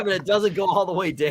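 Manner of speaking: fast, giggling through the punchline; cracking up while finishing the joke; laughing. Real, not acted. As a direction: laugh the words out.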